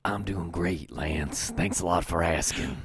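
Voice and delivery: low, soft imitation of a soothing Southerner; Brandon Schexnayder. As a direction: impersonate a soothing Southern podcast guest, deep and hushed. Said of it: "that is my Brandon Schexnayder impression".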